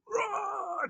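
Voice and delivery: very high strained "rah"; mock furious roar. Teacher imitating Lincoln's anger at McClellan, mock furious roar. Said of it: "furious, so angry".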